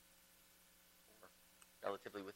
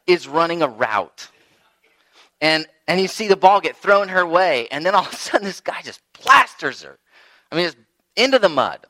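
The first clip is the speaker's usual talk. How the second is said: ordinary talk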